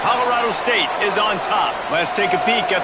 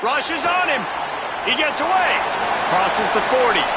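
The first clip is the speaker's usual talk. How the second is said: excited play call; 5 semitones higher than usual. Real, not acted.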